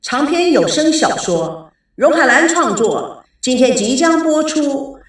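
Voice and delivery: slow, drawn-out program announcement; audiobook announcer. Acted. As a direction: announce the show grandly and slowly. Audiobook intro over music.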